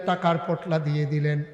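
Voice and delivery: ordinary talk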